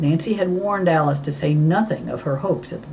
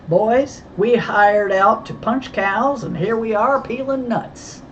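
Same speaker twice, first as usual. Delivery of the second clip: loud, higher, drawn-out cowboy griping; mock-aggrieved cowboy, voice raised; cowboy complaint; 6 semitones higher, 4 dB louder, slower than usual. A narrator's reading. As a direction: grumble it big and slow, like a ranch hand. Cowboy character grumbles jokingly while cracking nuts.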